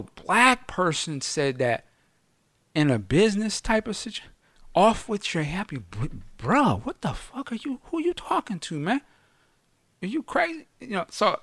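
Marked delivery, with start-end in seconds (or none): 4.7-10.7 high, hushed, incredulous mock confrontation; a mock outraged who-you-talking-to; imagined confrontation. Confronting an imagined insulter, high and incredulous.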